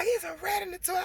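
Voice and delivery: put-on high, fast, insistent child voice; scared little girl. Daughter insisting to mom there's a rat, high and fast. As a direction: play the frightened daughter pleading. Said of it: "she like".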